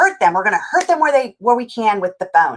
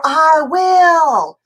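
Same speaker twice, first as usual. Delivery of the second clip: loud, higher, drawn-out backtalk; child's attitude; 6 semitones higher, 5 dB louder than usual. Retold or imitated. Child talking back to parent, with attitude. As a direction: imitate a kid snapping back with attitude. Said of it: "they have that attitude".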